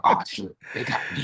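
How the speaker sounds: a hushed oops to yourself; whispered aside. Retold or imitated. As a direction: whisper it, caught out. Caught out, muttering to himself.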